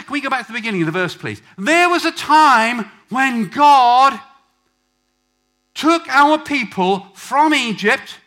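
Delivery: loud, high, impassioned sermon; full-force preaching. Real, not acted. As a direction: preach it out to the whole congregation.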